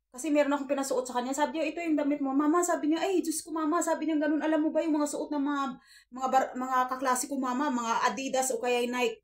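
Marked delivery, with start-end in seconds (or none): 1.7-2.6 put-on raised, louder voice of a pleading ten-year-old; mom's mock child voice, higher and louder; imitating her son. Mother voices her young son. 3.0-3.8 hammed-up child exasperation, raised pitch; imitating exasperated son. Son's exasperated complaint, retold. 4.4-9.2 put-on louder, raised kid voice, pleading; child nagging his mother for sneakers; mock kid wheedle, higher and louder; imitating son asking. Son asks for brand shoes.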